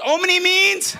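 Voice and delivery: loud call for the answer; loud rallying call-and-response; shouted question. Real, not acted. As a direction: push the crowd to answer. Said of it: "Come on, guys".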